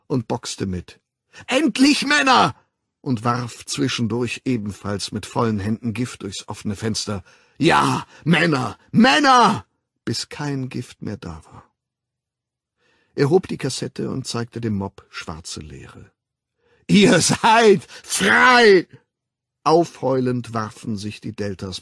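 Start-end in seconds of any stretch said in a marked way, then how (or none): 1.5-2.5 rallying shout. Acted. Fighting mob, rallying cry. 7.6-9.7 building rallying shout. Acted. Rallying the fighting mob. 16.9-18.9 triumphant proclamation. Acted. Showing mob the empty box.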